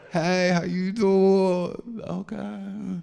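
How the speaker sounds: slow smooth flirt. Acted. Slow flirty pickup line.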